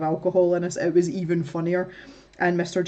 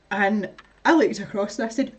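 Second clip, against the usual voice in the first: usual speaking voice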